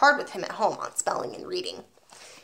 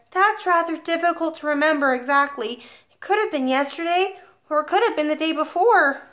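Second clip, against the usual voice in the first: put-on 1 cheeky small boy; 7 semitones higher, 4 dB louder than usual. Boy answers headmistress cheekily.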